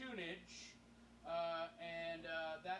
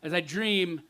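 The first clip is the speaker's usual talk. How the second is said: louder poetry recital easing down at the close; spoken-word poem; 2 semitones higher, 13 dB louder than usual. Acted. Poet tells the audience of getting home as a drunken lion, spoken-word recital. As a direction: recite it rhythmically, then let it settle.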